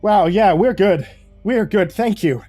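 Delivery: high, loud, eager acceptance; overjoyed gratitude. Acted. New hire accepting a huge wage, overjoyed. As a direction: burst out with grateful relief.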